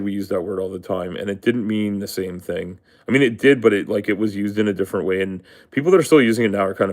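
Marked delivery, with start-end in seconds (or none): none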